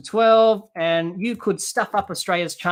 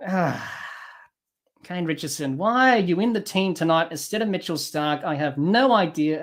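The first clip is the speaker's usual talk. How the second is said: usual speaking voice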